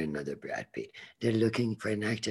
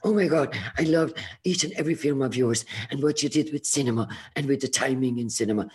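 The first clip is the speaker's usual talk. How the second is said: higher, louder, fast fan rush; excited gushing; 4 semitones higher, 4 dB louder, faster than usual. Retold or imitated. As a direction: pour it all out to your idol at once. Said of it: "I was so excited".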